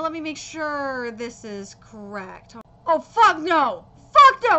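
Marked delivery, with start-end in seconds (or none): 2.9-4.6 furious refusal. Real, not acted. Fan hearing the wrong composer named, shouting no. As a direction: bellow it in disgust. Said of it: "You did not just say that shit".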